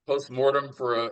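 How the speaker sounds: ordinary talk, nothing marked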